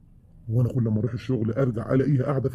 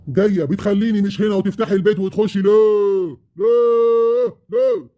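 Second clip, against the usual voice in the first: furious shout; 7 semitones higher, 5 dB louder, slower than usual. Acted. Husband yelling at ex-wife.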